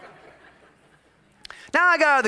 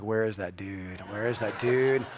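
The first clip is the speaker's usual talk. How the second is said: low seething search; 10 semitones lower, 6 dB quieter than usual. Retold or imitated. Hunting for the man.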